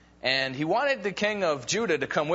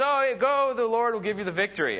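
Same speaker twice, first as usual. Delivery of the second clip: sarcastic; 7 semitones higher than usual. Retold or imitated. Prophet mocking the king with fake encouragement, sarcastic. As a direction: overdo the enthusiasm so it rings false. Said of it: "ridiculous sarcasm there".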